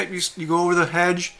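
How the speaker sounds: normal talking voice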